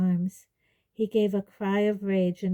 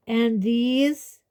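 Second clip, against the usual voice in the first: lightly voiced stern centaur, loud and higher; 4 semitones higher, 6 dB louder than usual. A narrator's reading. Centaur holding Hermione, lightly raised stern voice. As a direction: put it firmly to the herd. Centaur demands judgement on captives.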